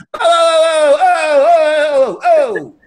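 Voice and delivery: very high, loud drawn-out "oh" yells; New York yelp. Retold or imitated. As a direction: bellow it like a fed-up New Yorker at baggage claim. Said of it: "Like New York fashion".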